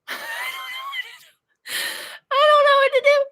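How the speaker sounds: very high, loud, flustered wail; helpless wail. Real, not acted. Host stuck with the software, helplessly wailing.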